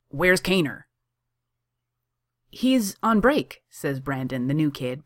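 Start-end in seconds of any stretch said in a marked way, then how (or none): 0.1-0.8 a short raised snap; snappish. A narrator's reading. Johnny demanding his coffee, snapping. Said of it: "coffee voice snap". 2.5-3.6 high, slow, chirpy reply; bright. A narrator's reading. New kid Brandon answering Johnny, brightly.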